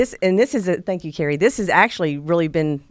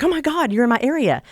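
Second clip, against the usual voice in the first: high, delighted squeal of surprise; excited; 9 semitones higher, 3 dB louder than usual. Retold or imitated. Retelling to the podcast host her delighted surprise at finding her friend nearby, excited.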